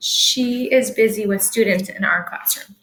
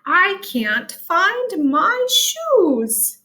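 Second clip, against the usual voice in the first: very slow and higher, each word set apart; teacher modelling a sight word in a sentence, slowly and higher; slow example sentence; 7 semitones higher, much slower than usual. Shown as a demonstration. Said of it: "The Y is making a long I sound".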